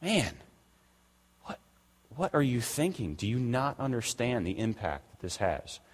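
like a shocked friend asking what you're thinking; exasperated disbelief. Retold or imitated. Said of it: "looks at me and says".

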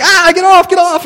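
high, loud frantic yell; yelling get off, way up high; panicked yell. Retold or imitated. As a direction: shout her off in panic. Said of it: "And I was like".

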